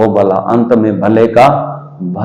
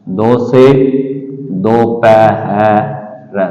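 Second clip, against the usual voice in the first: drawn out very slowly, sound by sound; धीरे धीरे खींचकर बोलना; slow stretched spelling; much slower than usual. Shown as a demonstration. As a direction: stretch दोपहर out slowly for a child. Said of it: "धीरे धीरे बोलिए".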